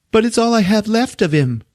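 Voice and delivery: high-pitched mock-weepy whine; tearful pleading. Patient begging nurse to keep the shoe, tearful high plea. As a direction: plead like a crying patient, hammed up. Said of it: "she's just crying".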